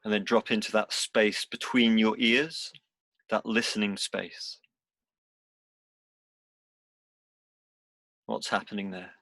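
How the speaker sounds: unhurried meditation guide, long pauses between phrases; guided meditation. Real, not acted. Teacher guiding seated students into body and breath, guided meditation. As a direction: lead them gently through it, leaving space after each instruction.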